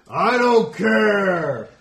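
high loud drawn-out yell; angry slow roar. Acted. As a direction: bellow it slowly at him. Standoff escalates to shouting.

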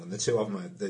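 normal talking voice

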